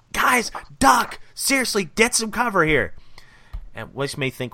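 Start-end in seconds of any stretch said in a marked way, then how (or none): 0.1-2.9 urgent warning. Retold or imitated. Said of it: "he goes".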